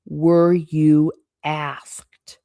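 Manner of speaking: slow hushed "Were you asked"; whisper. Acted. Hostess repeating to the guest, a slow whisper. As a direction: hiss the question slowly.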